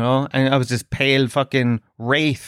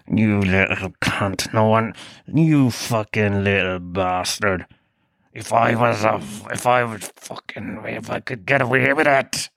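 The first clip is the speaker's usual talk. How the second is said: low, slow menace sinking into a whisper; menacing threat; 4 semitones lower than usual. Retold or imitated. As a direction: threaten a child under your breath, hammed-up. Acted menacing threat at the boy.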